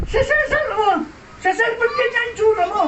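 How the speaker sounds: high, shocked cry with a laugh; shocked recognition. Retold or imitated. Rodel recognizing his best friend, high and shocked. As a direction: cry out in disbelief at seeing him.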